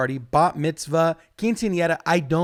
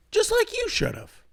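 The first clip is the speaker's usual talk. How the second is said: mocking jab. Mocking sing-song jab at crowd.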